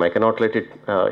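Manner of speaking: ordinary talk, nothing marked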